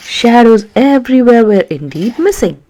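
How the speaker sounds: ordinary delivery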